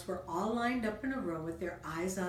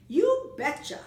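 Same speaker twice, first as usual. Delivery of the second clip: put-on high, loud cheery Minnesota accent; a Minnesota "you betcha", bouncy and loud; cute Minnesota accent; 8 semitones higher, 9 dB louder than usual. Bubba answers Cherie, Minnesota accent. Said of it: "in his cute Minnesota accent".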